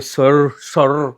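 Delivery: छात्र की पुकार. Retold or imitated. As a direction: imitate a student calling out, low and stretched. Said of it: "आप कहोगे". (खींचकर सर को पुकारते छात्र की नकल)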